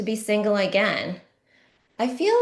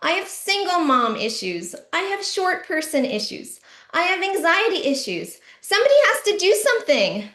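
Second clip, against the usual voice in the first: yelling; 8 semitones higher than usual. Retold or imitated. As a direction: shout your grievances at the whole line. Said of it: "So I started yelling".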